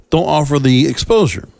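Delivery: usual speaking voice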